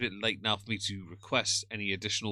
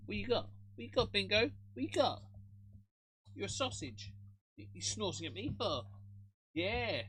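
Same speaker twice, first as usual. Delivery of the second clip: high sing-song pet talk, much higher; talking to the cat; 11 semitones higher, 4 dB quieter than usual. Real, not acted. Streamer teasing her kitten Bingo, high cooing pet voice.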